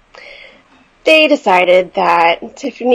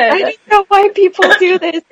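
high tearful whine; whimpering through tears, pitched up; retold crying; 9 semitones higher than usual. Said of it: "I was crying because I'm like".

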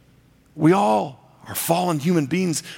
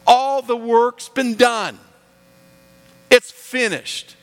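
slow, high, loud proclamation; voicing Jesus in emphatic triumph; triumphant declaration; 8 semitones higher, 5 dB louder, slower than usual. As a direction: proclaim it slowly, word by word, ringing out. Preacher voices Jesus declaring victory.

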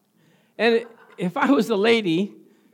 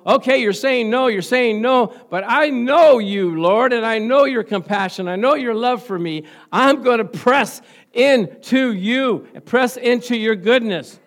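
pressing prayer; 4 semitones higher, 7 dB louder than usual. Acted. The woman pressing into the Lord despite his no, loud and determined. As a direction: press in and refuse to give up.

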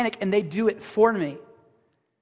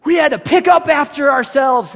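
higher and louder, hammed-up grumpy elder lecturing kids; mock old-timer; 4 semitones higher, 6 dB louder than usual. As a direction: play a fussy old parent bragging about hardship. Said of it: "And you're gonna be like".

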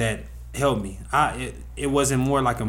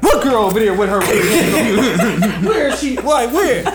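loud, very high, laughing blurted questions; teacher loudly blurting out gossip, high and laughing; can't whisper; 10 semitones higher, 6 dB louder than usual. Retold or imitated. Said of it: "so she can't whisper".

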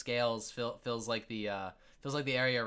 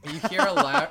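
usual speaking voice

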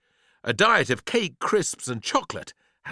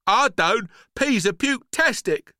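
put-on high, loud child's retort; defiant boy; 11 semitones higher, 6 dB louder than usual. Boy tells the peas off, defiant. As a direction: blurt it back at them.

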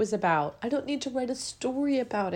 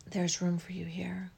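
whispered affirmation; 4 semitones lower, 7 dB quieter than usual. Shown as a demonstration. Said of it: "So I'm gonna say it again".

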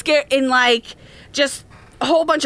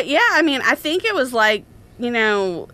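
normal talking voice

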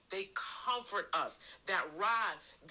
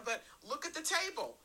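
higher, building preacher's call; climbing into full preaching voice; rising sermon fervor; 7 semitones higher than usual. Acted. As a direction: lift the congregation toward the table.

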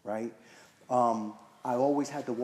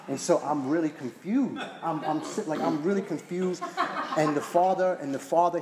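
high and louder, tumbling along; excited retelling; 6 semitones higher, 6 dB louder than usual. Real, not acted. Telling the meeting how baffled he was, excited. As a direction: build the story with rising energy.

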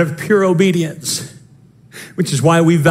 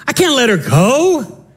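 ordinary delivery

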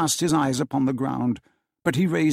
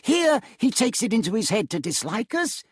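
higher, light amused voice; good-natured nephew; 9 semitones higher than usual. A narrator's reading. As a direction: forgive him with a smile.

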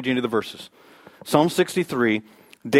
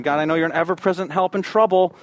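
raised, pleading prayer; praying for help; 5 semitones higher than usual. Retold or imitated. Stranded mother praying to God for help, pleading. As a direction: pray it out, rising higher on the plea. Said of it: "she was praying".

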